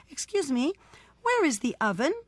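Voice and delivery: high, polite inquiry; polite baker woman. A narrator's reading.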